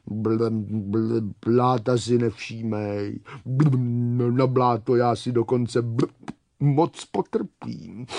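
low, burbling contented voice; bubbling water creature. Acted. Water scorpion says she likes mud, bubbling. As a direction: blub low between the words.

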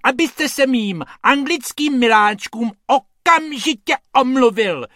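very high, loud demand; shrieking indignant lady. A narrator's reading. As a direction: screech for an apology, outraged. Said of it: "ječí ta dáma silným hlasem".

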